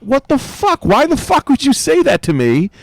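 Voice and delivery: high, indignant shout of disbelief; retold outburst. Comic recalls snapping at a fan who said his selfie partners died, mock outraged. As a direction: blurt it out shocked and offended. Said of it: "And I went".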